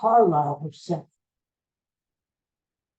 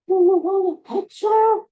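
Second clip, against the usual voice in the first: high, loud put-on voice; voice from the SUV; 13 semitones higher, 6 dB louder than usual. Unseen star calls to a waiting fan from the SUV, high put-on voice. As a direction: pitch it way up and call out. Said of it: "I hear a voice coming out of the S U V".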